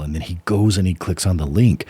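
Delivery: ordinary talk, nothing marked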